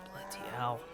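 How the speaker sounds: usual speaking voice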